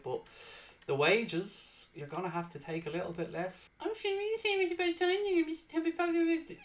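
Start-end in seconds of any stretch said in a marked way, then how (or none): 3.8-6.6 high, squeaky put-on voice for the player; mock player voice. Manager mocking the player in a high put-on voice. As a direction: pipe it up like a cheeky footballer.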